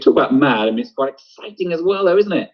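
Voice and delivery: rising high, lightly thrilled read; excited Kathy. Acted. Teacher reading Kathy's line to the class, mildly thrilled, pitch climbing. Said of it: "she's getting quite excited about it".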